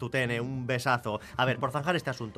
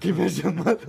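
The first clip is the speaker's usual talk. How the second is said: louder, laughing feigned indignation; laughing mock outrage; 5 semitones higher, 6 dB louder than usual. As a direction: pretend offended through laughter. Mock offense while laughing.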